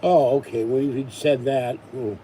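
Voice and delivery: ordinary talk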